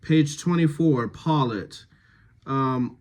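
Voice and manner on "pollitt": ordinary delivery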